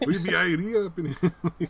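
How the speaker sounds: hammed-up Jamaican accent with laughter; mock Jamaican accent. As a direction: parody a bad Jamaican impression while cracking up.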